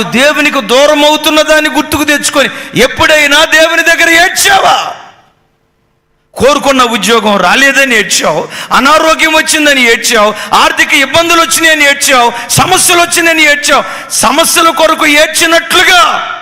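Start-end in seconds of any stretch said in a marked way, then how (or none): none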